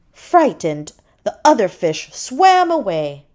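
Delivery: ordinary delivery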